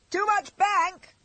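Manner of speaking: high, slow shout from the back seat. A narrator's reading. Stuffy shouting from the back of the swerving carriage. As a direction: call it out over the rattle. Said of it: "shouted Stuffy from the back seat".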